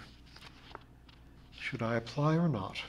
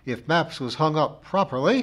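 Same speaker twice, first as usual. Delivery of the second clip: raised, louder reproving voice; severe; 5 semitones higher, 7 dB louder than usual. A narrator's reading. Missus Pringle blames the narrator for the maps, severe. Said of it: "continued Missus Pringle severely".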